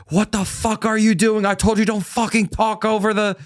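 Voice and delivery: high, yelled fury at the puppeteer; imitating a screaming director. Director screaming at the puppeteer over the mic, furious and high. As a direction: bark it like an enraged director losing it. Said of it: "the director started fucking screaming at him".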